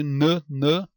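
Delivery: "n, n" como nâ, nâ isolados; som de consoante. Shown as a demonstration. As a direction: solte "n, n" em sílabas curtas. ("n, n" dito nâ, separado)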